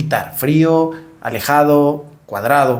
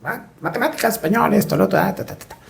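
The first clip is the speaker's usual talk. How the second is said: put-on kid's reply; 5 semitones higher, 4 dB quieter, faster than usual. Son rattling off school subjects.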